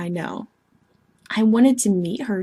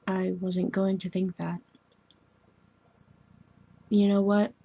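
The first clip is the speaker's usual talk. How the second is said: ordinary talk, nothing marked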